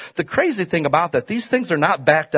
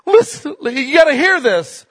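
higher, louder, begging God to listen; pleading prayer; 10 semitones higher, 4 dB louder, slower than usual. Acted. Preacher voicing the prayer to God, pleading and climbing high. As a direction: cry out to God, the word listen jumping way up.

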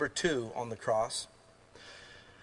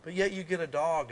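ordinary talk, nothing marked

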